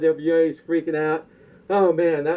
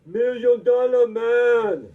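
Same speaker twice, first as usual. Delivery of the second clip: higher, slow put-on voice; impersonating a wrestler; 4 semitones higher, much slower than usual. Wrestler handing over the belt, slow put-on voice. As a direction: drag out Million Dollar Man in a put-on voice. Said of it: "He's like".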